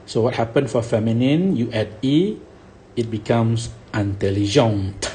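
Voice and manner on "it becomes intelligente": ordinary talk, nothing marked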